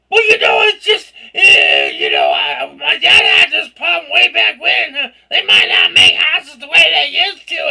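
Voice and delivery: loud, high, laughing ramble; cackling flustered retelling; laughing shout. Retold or imitated. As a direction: laugh through the embarrassed story. Retelling her rambling to the buyers.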